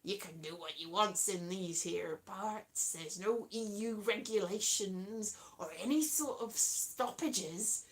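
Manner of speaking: low, rolling pirate growl; mostly soft, low West Country pirate. Acted. Pirate telling newcomers about free ports, low pirate voice. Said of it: "That's a pirate voice, isn't it?".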